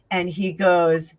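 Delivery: normal talking voice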